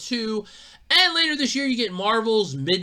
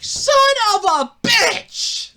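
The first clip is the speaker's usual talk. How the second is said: frustrated yell; 7 dB louder, much slower than usual. Retold or imitated. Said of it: "I'm like".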